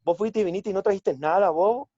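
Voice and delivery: sing-song Ocaña accent, mildly voiced. Shown as a demonstration. Actor demonstrating the Ocaña accent with a musical lilt. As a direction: lilt it like someone from Ocaña teasing a friend. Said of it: "Ellos tienen una musicalidad así".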